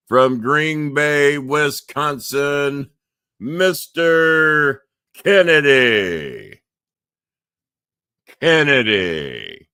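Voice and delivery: booming, drawn-out ring announcer call, high and loud; ring announcer intro. Shown as a demonstration. Host announcing his guest to the live audience, booming ring call. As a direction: stretch every word like a big arena introduction. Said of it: "how I tried to introduce him the last time".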